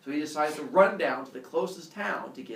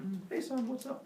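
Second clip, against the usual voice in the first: soft, higher-pitched casual dad greeting; Zeus; 11 semitones higher, 5 dB quieter than usual. Retold or imitated. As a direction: answer a call from a son, casually. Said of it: "And Zeus calls back".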